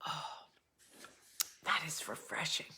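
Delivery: hushed, whispered satisfaction after a sip; very soft, breath-only aside; whispered relief. Real, not acted. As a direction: whisper it just after swallowing. After sipping the drink.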